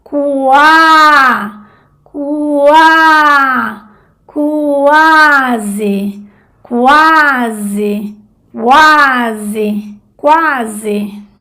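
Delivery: cuasi with strong A, weak U; diphthong demo. Shown as a demonstration. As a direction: lean on the A of cuasi. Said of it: "la A es la vocal porque es más fuerte". (diptongo en cuasi, A fuerte)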